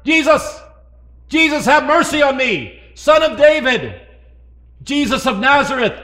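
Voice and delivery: high, loud pleading cry; imitating a desperate yell. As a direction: call out to be heard far away. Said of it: "the blind man's been yelling".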